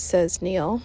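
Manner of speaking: plain reading voice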